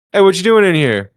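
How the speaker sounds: loud, high imitation of a challenge; challenging call-out. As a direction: call out to a stranger who doesn't belong. Gang member challenges the driver.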